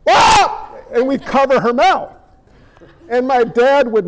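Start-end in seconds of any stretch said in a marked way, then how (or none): 0.1-0.7 short shouted "Ah!" cry of alarm, high and loud; a quick startled scream; sudden scream. Retold or imitated. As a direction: yell out "Ah!" like someone startled in the night. Retold scream in family story.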